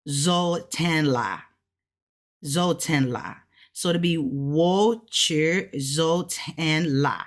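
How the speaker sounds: slow, careful Mandarin syllables; Mandarin practice. Shown as a demonstration. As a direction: sound out "Zo ten la" slowly in Mandarin. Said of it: "The la particle indicates completed".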